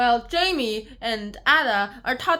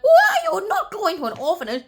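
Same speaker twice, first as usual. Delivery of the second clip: high, loud cry of disbelief; startled objection; 9 semitones higher, 7 dB louder than usual. Retold or imitated. Caretaker to children about the orphanage, startled objection. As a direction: burst out high, then ease off.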